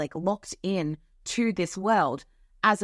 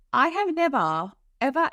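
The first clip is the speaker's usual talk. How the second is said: usual speaking voice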